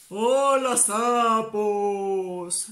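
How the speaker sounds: loud monster roar. Acted. Monster greeting the toads with a loud roar. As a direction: greet them like a hungry beast. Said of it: "rugió el monstruo".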